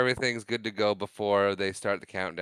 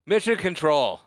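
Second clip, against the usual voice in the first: higher-pitched launch call; mock radio call; 6 semitones higher, 4 dB louder than usual. Host acts out the shuttle crew ready to launch, calling out. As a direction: call out briskly to mission control.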